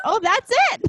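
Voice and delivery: high, loud delighted cry; squealing oh that's it; excited cheer. Retold or imitated. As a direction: yell out like it finally clicked. Husband reacting to the impression.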